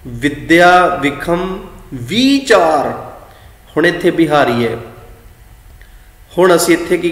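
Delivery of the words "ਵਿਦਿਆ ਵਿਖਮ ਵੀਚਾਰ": slow chanted gurbani line; slow recited line. Shown as a demonstration. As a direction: draw out ਵੀਚਾਰ with a long ee. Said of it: "ਬਿਹਾਰੀ ਨੂੰ ਥੋੜਾ ਲਮਕਾ ਕੇ ਪਾਠ ਕਰਨਾ ਹੈ". (ਵੀਚਾਰ ਨੂੰ ਲਮਕਾ ਕੇ ਹੌਲੀ ਪਾਠ)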